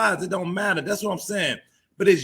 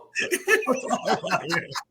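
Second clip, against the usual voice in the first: real laugh; 5 semitones higher than usual. Real, not acted. Laughs after the quip.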